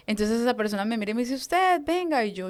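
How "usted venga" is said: high-pitched retold command; imitated summons. Liberation leader picking her out of the group, imitated summons. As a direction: call her over in a higher voice.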